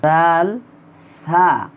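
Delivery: জাল with tongue tip on the upper front teeth; isolated letter shown clearly; letter demo. Shown as a demonstration. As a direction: sound জাল slowly so students hear the tongue tip. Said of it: "জিহ্বার আগা ... লাগিয়ে উচ্চারণ করতে হবে।".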